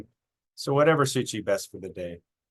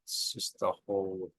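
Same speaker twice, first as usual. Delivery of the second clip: lower, softer meditation voice with long pauses; guided meditation; 4 semitones lower, 4 dB quieter than usual. Acted. Guiding listeners' attention up from their toes, calm meditation voice. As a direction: ease them gently through a body scan, unhurried. Leads a body scan meditation.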